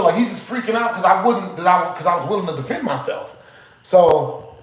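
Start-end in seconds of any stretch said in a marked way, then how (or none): none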